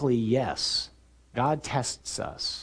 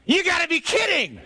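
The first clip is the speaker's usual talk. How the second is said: high-pitched holler of disbelief; incredulous yell; 4 dB louder than usual. Acted. Apostle hearing Jesus' question in the storm, incredulous yell. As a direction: shout it over the storm, incredulous.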